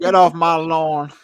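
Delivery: put-on slow grumpy Southern old-timer; Southern accent. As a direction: drawl it like a cranky old man. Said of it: "What accent is that?".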